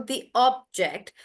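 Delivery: "object" slow, stressed on OB; noun stress. Shown as a demonstration. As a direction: hit the first syllable of "object". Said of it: "the syllable stress is changing".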